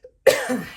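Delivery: short dry cough; real cough. Real, not acted. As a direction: cough once, unforced. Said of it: "my occasional coughing".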